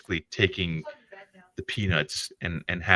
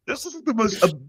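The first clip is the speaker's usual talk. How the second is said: ordinary talk, nothing marked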